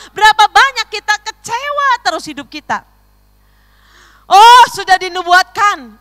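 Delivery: high, loud impassioned preacher; loud, high-pitched sermon cry; full-force preaching. Real, not acted. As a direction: hammer the rhetorical questions home to the congregation.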